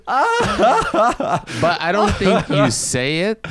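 talking through high, loud-ish laughter; laughing. Real, not acted. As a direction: laugh your way into the objection, high-pitched. Laughing after own silly toast.

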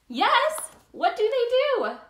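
high and loud eager burst; rally the kids with delight; excited. Acted. Eager question to child audience.

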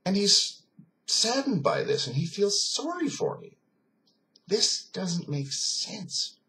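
high, strained, incredulous reasoning; baffled disbelief. Retold or imitated. German man puzzling over the soldier's pity, baffled. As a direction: pitch climbs as each fact refuses to add up.

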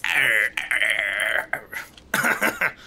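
a rough pirate-crab growl, badly done; mock the grumpy crab boss; half-hearted pirate crab arr; Mister Krabs impression. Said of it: "my worst Mister Crab impression".

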